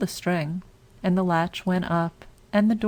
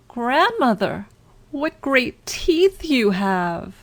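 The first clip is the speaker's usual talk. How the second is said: slow, alarmed wonder; 6 semitones higher, 3 dB louder, slower than usual. Acted. Girl grows alarmed.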